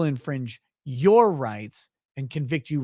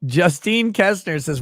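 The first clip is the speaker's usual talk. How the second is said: usual speaking voice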